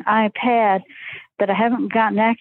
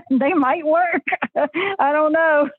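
laughing; 7 semitones higher, slower than usual. Real, not acted. Laughing while speaking.

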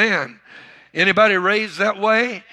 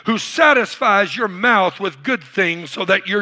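preaching scripture; 4 semitones higher than usual. Acted. Pastor reading Psalm 103 to his church, full-force preaching. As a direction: proclaim the psalm to the congregation.

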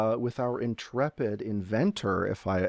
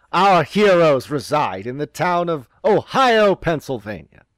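narrator voice; 5 semitones higher, 7 dB louder, slower than usual. Acted. GM narrating opening scene.

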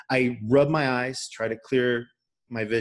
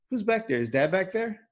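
lightly voiced sister, higher pitch; mock puzzled sister asking, pitched up; pitched-up retelling of a little sister's question; imitating sister; 8 semitones higher than usual. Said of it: "and she's like".

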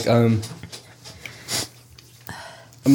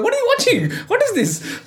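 much higher, mock-alarmed mum; imitating a shocked mum; high, hammed-up panic.

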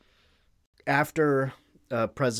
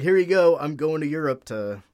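higher, much louder mock-cheerful Bannon; hammed-up eager helper, pushing it loud; 3 semitones higher, 7 dB louder than usual.

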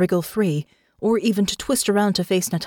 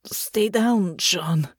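dreamer's panicked mumble, mildly voiced; a touch of panic, talking in sleep; slurred, understated alarm; much slower than usual. A narrator's reading. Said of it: "slurred and laced with panic".

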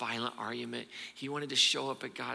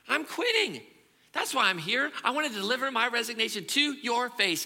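loud and high, declaring it; defiant shout; 10 semitones higher, 6 dB louder than usual. Acted. Elijah loudly quitting to God's face. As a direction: throw the resignation in God's face.

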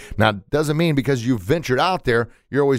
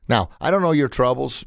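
ordinary talk

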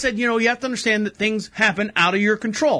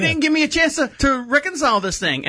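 high, half-laughing objection; retold mock disbelief; incredulous protest; 7 semitones higher than usual. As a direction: protest in a high voice at being cut off. Retelling his protest at being cut off.

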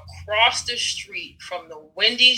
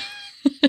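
Rocky triumph yell. Retold or imitated. Imitating Rocky's victory scream at the top. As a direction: belt it out like Rocky on the steps. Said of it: "and he's like".

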